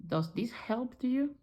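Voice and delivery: ordinary talk, nothing marked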